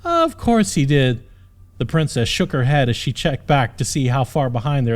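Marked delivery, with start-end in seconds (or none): 0.0-1.2 exasperated sarcasm. Acted. Princess exasperated.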